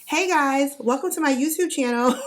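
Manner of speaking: high, loud peppy YouTuber intro; bubbly YouTuber greeting. Pretend. Childish vlogger greeting her viewers, high and loud. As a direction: chirp it like a cheerful influencer. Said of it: "I wanted to be like".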